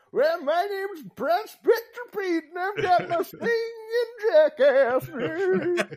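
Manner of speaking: put-on high, slow old prospector voice; hammy high-pitched grizzled prospector; Prospector Pete. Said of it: "Pete's the old prospector".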